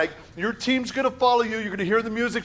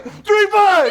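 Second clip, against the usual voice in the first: shout; 13 semitones higher, 10 dB louder than usual. Real, not acted. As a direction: rally call shouted high and loud. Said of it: "you yell".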